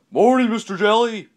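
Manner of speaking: loud, high-pitched neighborly yell; yelled greeting. A narrator's reading. Mister Yell calling to his neighbor from a window, yelling. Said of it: "he yelled".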